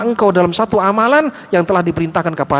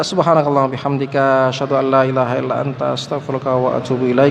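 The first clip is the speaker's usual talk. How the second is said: a lowered, recited closing supplication; closing prayer; 7 semitones lower than usual. Acted. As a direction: murmur the closing dua as recitation.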